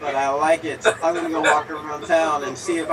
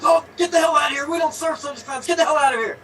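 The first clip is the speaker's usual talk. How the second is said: high, loud angry shout; losing it; 9 semitones higher, 4 dB louder, faster than usual. Acted. Bartender throwing out circus clowns, furious shout. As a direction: bellow it as a bartender at breaking point. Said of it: "the bartender just loses it".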